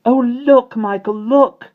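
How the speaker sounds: put-on very high, loud child; thrilled little-girl exclamation; excited cry. A narrator's reading. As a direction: squeal with excitement to a brother. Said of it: "Jane cried excitedly".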